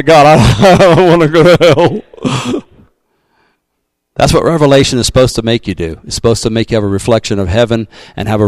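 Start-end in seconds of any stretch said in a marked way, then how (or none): 0.0-2.6 laughing mock prayer; half-laughing plea to God, a bit louder. Telling the church what to pray at home, laughing mock plea. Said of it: "you ought to go home and say".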